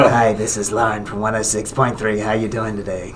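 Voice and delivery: low, soft pack-a-day smoker version; Lauren impersonation. Acted. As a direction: drop the pitch and volume for a mock smoker's radio greeting. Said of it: "like a pack-a-day transvestite smoker".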